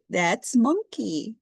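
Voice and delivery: usual speaking voice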